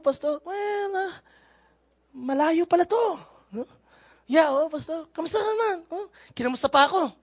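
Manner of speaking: light playful soft voice; soft, high-voiced retold chat. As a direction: retell the exchange softly, teasing. (mahinang pabirong kuwento)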